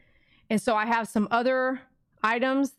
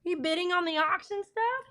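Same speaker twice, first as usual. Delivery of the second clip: higher cooing question to the dog; 7 semitones higher than usual. Real, not acted. Owner asking her dog a question in high baby talk. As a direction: coo it at the puppy.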